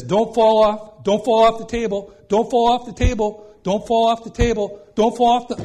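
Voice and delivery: loud, high-pitched repeated plea; acted urgent warning. Acting out warnings to a book.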